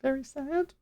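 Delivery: high, whimpering fake sob; mock weeping. Apprentice fake-crying to second merchant, hammed-up weeping. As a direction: squeak it out like a put-on cry. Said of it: "Shameless weeping".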